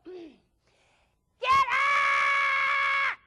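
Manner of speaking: high, loud barked wake-up; guttural shout. Retold or imitated. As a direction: bellow at the kids to get out of bed. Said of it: "that guttural sound in the morning".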